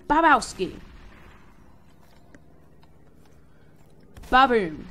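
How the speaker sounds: loud victory yells; excited shout. Real, not acted. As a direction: bellow each hit. Killing zombies.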